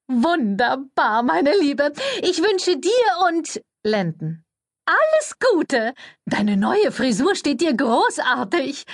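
high, slow sugary publicist voice; gushing flatterer. A narrator's reading. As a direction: lay the flattery on thick and sweet. Cordelia flattering Thursday.

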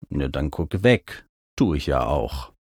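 low, unbothered retort; indifferent Mac. A narrator's reading. Mount tells distressed narrator to look away, indifferent. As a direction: shrug it off in a low voice.

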